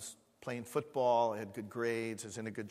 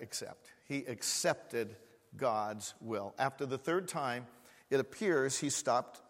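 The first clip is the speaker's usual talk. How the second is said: usual speaking voice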